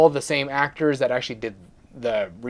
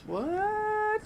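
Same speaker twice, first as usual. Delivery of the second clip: surprised what; 9 dB quieter than usual. Real, not acted. Mock shock aside about giveaways.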